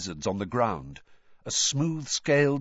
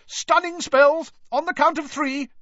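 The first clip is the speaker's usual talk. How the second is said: shouted order; 6 dB louder than usual. Acted. Keeper calling orders to the dragon keepers, loud and high. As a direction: bark out the order to the team.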